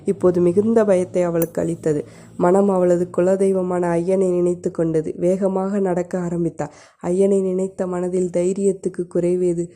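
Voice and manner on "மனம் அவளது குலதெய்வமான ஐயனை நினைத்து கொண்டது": plain reading voice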